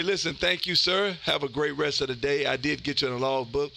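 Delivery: ordinary talk, nothing marked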